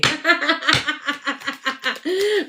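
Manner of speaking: amused laughter; high amused laugh. Real, not acted. Laughing at own joke.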